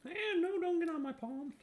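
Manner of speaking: high, loud squeal of alarm; startled protest. Real, not acted. Streamer reacting to cheese dripping on her hand, high startled yelp. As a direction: yelp as the cheese runs onto your palm.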